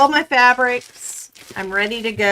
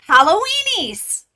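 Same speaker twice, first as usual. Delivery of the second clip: gleeful exclamation; 9 semitones higher, 5 dB louder than usual. Real, not acted. Reading the sticker name.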